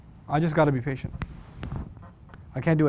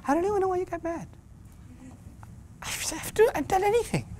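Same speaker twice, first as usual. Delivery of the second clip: put-on much higher, fast whiny kid voice; whiny innocent teen; 12 semitones higher, 7 dB quieter than usual. Teen protesting to parents, high and whiny. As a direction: whine that you did nothing. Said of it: "I love that line".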